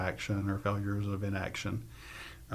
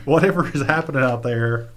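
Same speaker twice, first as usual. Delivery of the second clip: laughing; 4 semitones higher, 8 dB louder than usual. Real, not acted. Guest quoting her own reaction while still laughing. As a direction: still laughing, higher and louder.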